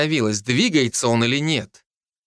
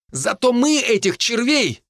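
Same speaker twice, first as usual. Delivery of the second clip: high, indignant counterargument; high-pitched squabbling; defensive bickering; 10 semitones higher than usual. Acted. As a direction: argue back in a raised pitch, ready to go on. Hinnigan keeps arguing, gets cut off.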